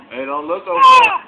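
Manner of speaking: loud high call across the room. Real, not acted. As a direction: shout it to stop them looking. Calling out to someone.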